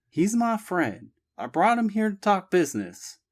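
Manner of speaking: insist, a bit defensive; Abby insisting. A narrator's reading.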